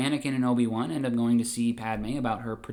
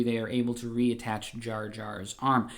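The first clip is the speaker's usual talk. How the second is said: ordinary delivery, nothing marked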